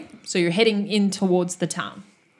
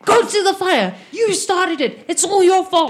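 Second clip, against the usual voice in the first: angry; 10 semitones higher, 5 dB louder than usual. Acted. NPC blaming the party.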